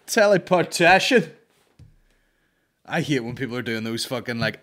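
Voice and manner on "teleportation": loud, high, slow disbelief; loud incredulous repeat. Real, not acted. Podcast host mocking the guest's answer, loud slow disbelief. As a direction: bellow the word back in mock disbelief.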